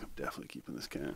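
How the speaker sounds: hushed, fast whisper of a private vow; a quick secret whisper; whispered aside. Retold or imitated. Quoting his own resolve.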